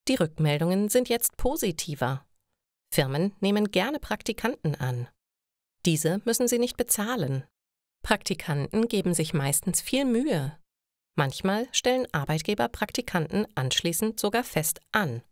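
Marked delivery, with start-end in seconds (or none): none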